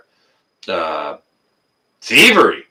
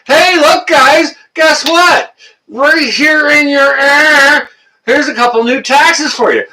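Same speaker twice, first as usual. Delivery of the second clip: high, loud, slow fake-cheerful pitch; a hammed-up smarmy politician announcing new taxes; mock politician; 6 dB louder than usual. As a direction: sell new taxes with a phony grin. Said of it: "they say".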